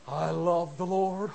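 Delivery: slow, gushing worship voice; mock showy praise. Preacher mocking a showy worshipper slowly. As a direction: overplay the pious sigh.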